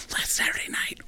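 hushed, scandalized whisper; super nervous. Retold or imitated. Agent confiding party gossip to comic, nervous whisper. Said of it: "super nervous".

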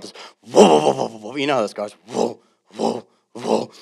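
vocal imitation of a revving muscle car; revving engine noise. Pastor imitates the neighbor's muscle car revving at dawn. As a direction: rumble it like an engine starting up.

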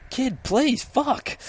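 high, strained plea; desperate pleading. Retold or imitated. Detective begging the runaway boy, desperate cry. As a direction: act out an exasperated, high-pitched appeal.

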